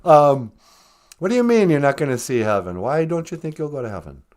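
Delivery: normal talking voice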